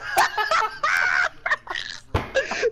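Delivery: shrieking laugh; can't stop laughing; high laugh. Real, not acted. Laughs at the story.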